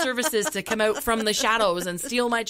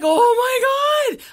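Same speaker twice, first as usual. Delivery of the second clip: drawn-out cry of relief; 12 semitones higher, 9 dB louder, much slower than usual. Retold or imitated. Relief on hearing son is safe.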